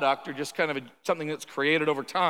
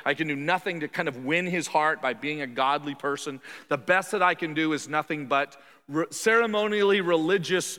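ordinary talk, nothing marked

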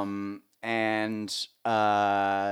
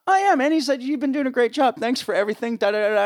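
high, loud enthusiastic thanks; the star thanking the crew, excited and loud; gushing praise; 9 dB louder than usual. Retold or imitated. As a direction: lay the praise on eagerly. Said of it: "he's going".

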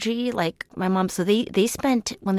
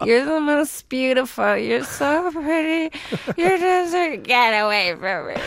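gushing high, then turning cold; moody drunk friend; 6 semitones higher, 3 dB louder than usual. Pretend. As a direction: swing from doting to snapping. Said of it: "a friend with an alcohol problem".

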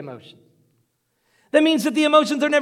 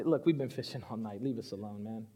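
low, very soft tired grumble; weary fishermen; 13 semitones lower, 16 dB quieter than usual. Acted. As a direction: brush him off wearily, under your breath. Weary fishermen answer Jesus.

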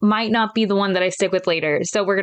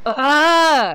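frustrated groan; 5 semitones higher, 5 dB louder than usual. Shown as a demonstration. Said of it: "more frustration".

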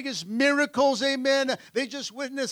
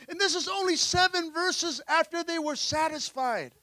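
ordinary talk, nothing marked

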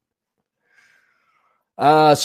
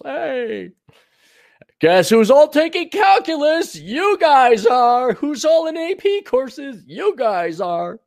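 mock proud parent; 11 semitones higher, 4 dB louder, slower than usual. Mocking proud suburban parent.

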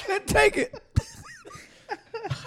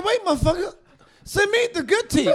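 high, heated mock protest; retold protest; 7 semitones higher than usual. Client to his dentist friend, an indignant high protest. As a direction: push back on the dentist, hammed-up. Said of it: "I said".

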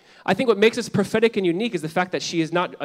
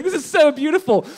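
high, louder gushing awe; moved gush; 9 semitones higher, 5 dB louder than usual. Retold or imitated. Pastor recalling watching the show, gushing with excited awe. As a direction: burst out, touched, with delight. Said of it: "I'd be like".

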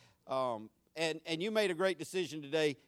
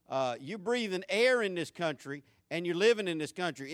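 ordinary delivery

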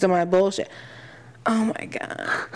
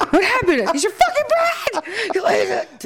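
laughing mock taunt; 13 semitones higher than usual.

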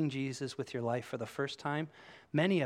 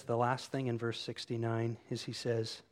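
normal talking voice

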